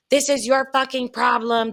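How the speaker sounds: retold yell, only slightly pushed; mock yelling. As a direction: mimic her nagging accusation, half-yelled. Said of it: "yelling at me".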